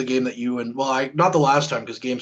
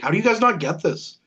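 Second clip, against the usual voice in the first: high-pitched, incredulous mock annoyance; retold exasperation; 8 semitones higher than usual. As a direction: replay your frustration at friends missing the joke. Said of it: "I was visibly mad about it".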